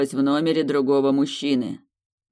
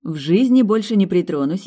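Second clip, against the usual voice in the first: smiling; 5 semitones higher, 7 dB louder than usual. Acted. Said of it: "с улыбкой перефразировала".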